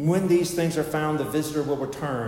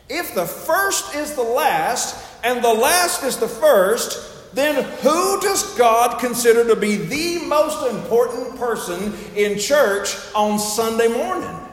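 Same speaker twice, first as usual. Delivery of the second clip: raised, loud, slow pulpit question; preaching; 10 semitones higher, 8 dB louder, slower than usual. Real, not acted. Preacher asks the congregation who matters most on Sunday, ringing pulpit delivery. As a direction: put the question to the whole church, slow and emphatic.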